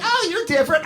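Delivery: very high mock-female squeal; high, gushing imitation of women; smitten women. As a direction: pitch it way up like an impressed admirer. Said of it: "They're like".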